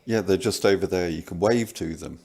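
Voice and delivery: loud, fast, mocking; sarcastic mockery. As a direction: wave from the battlefield as if it were normal. Sarcastic mock of battlefield families.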